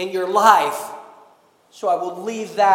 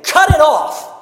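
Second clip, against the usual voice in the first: higher and louder, a forceful preached command; 6 semitones higher, 6 dB louder than usual. Real, not acted. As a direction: bark it out like a decree from the pulpit.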